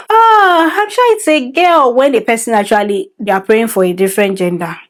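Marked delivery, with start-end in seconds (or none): 0.1-1.9 put-on high, excited "Ah, I'm sure it's a girl"; hammed-up confident guess; imitating a know-it-all guess. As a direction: play the nosy auntie who just knows. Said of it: "the way to showing up".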